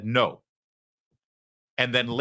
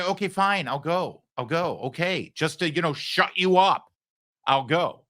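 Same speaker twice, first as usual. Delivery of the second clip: grudging give-in; 6 semitones higher than usual. Retold or imitated. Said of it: "Wouldn't you say".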